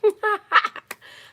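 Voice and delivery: loud, high burst of laughter. Real, not acted. Laughs to herself on livestream.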